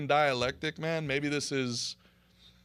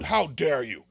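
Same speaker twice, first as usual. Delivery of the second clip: mocking offended voice, a little louder; hammed-up imitation of an indignant defender; mock indignation; 5 dB louder than usual. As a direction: parody their outrage. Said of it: "they're like".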